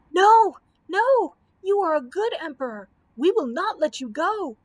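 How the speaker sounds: high, loud shrieks of protest; a crowd crying out no, shrill and loud; shrieking crowd. Acted. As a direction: people shrieking to keep their emperor. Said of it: "shrieked the people".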